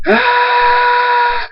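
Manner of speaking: very high, drawn-out scream, breaking off and resuming. Acted. Thunderstorm in agony as the castle falls, long broken scream. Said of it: "Thunderstorm screams".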